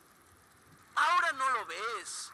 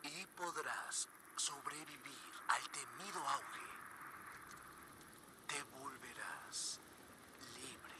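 whispered; 9 semitones lower, 13 dB quieter than usual. Acted. Cult leader's hushed promise.

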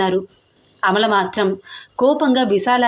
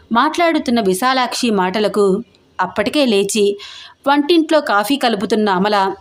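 plain reading voice

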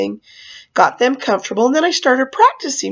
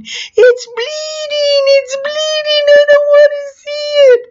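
very high, wailing shrieks; imitated screaming kids; 4 dB louder, slower than usual. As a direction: wail like a crowd of horrified children. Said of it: "all the kids started screaming".

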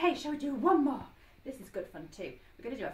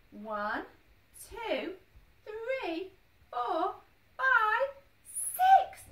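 counting up, building louder and higher; teaching a child to count; 7 semitones higher, 6 dB louder, much slower than usual. Shown as a demonstration. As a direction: build excitement with each number. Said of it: "Can you count with me?".

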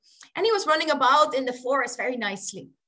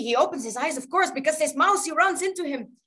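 usual speaking voice

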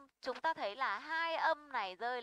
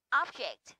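"object" with a loud stressed O; first-syllable stress; 4 dB louder than usual. Shown as a demonstration. Teacher gives noun example, first-syllable stress. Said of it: "rơi vào o".

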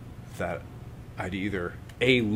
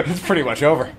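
real laugh, much louder and higher, through the words; laughing at the time; 5 semitones higher, 10 dB louder, faster than usual. Real, not acted. Teacher checking the clock, laughing loudly. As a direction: laugh it out, caught short on time.

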